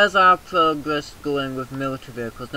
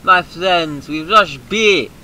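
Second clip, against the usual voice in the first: slow, loud hammed-up rallying speech; theatrical leader urging charge; mock rousing call; 4 semitones higher, 8 dB louder, slower than usual. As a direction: rouse the troops with grandeur. Rallying cry.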